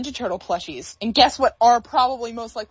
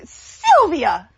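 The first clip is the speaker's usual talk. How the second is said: higher, louder call of a name; Wander calling Sylvia; 4 semitones higher, 6 dB louder than usual. Retold or imitated. Friend imitating Wander calling Sylvia. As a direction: call out to Sylvia like the cartoon character. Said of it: "it's the one from when he's like".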